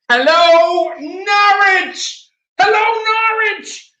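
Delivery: very high, loud mock crowd greeting; hammed-up shout. Streamer answers a hello from Norwich, hammed-up high shout. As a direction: holler it high, as if to a whole town.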